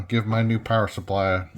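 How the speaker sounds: ordinary delivery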